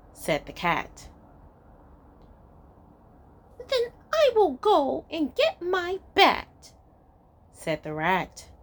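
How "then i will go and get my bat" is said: high squeaky rat. Acted. Rat threatens cat.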